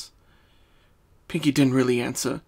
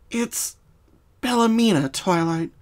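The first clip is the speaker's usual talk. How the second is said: hurt correction; 8 semitones higher than usual. Acted. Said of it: "almost hurt that she had".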